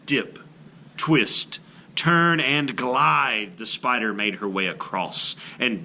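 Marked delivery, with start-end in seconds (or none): none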